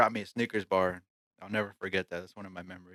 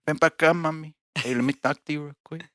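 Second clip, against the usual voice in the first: put-on higher-pitched paisa catcall in Mexican accent; 6 semitones higher than usual. Calling a girl over, playful Mexican paisa accent. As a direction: coax in a hammed-up Mexican accent. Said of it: "my deep accent".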